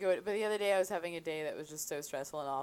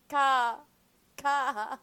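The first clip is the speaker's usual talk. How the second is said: seagull caw; 6 semitones higher, 5 dB louder than usual. Retold or imitated. Imitating seagull cry.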